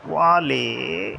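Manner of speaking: वाले drawn out very slowly, climbing upwards; long rise on वाले. Shown as a demonstration. Teacher showing the third component, long rising वाले. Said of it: "फिर एक लंबा सा rise आया".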